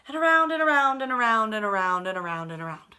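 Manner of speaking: fast, loud around and around; rapid repeated around. Acted. Reader mimics Norman circling his bowl, rapid and loud. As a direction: rattle it off like the fish circling. Repetitive swimming read fast.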